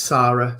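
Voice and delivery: Sarah with a long open AH, SAH-ra. Shown as a demonstration. Host on a friend's preferred name, Sarah said as SAH-ra. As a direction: say Sarah with the first vowel of "father". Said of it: "a friend who spells a name like that".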